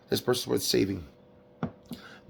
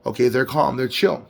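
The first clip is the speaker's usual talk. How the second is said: ordinary talk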